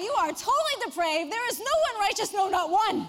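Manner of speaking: hammed-up stern preacher, laughing; mock preaching. Teacher imagines preaching depravity at the boy, mock shout. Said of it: "I wanted to say".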